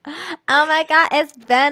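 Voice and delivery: high, loud, slowed-down mock gushing; mocking impression. Friend mimicking how another talks, mocking impression. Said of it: "I don't say it like that".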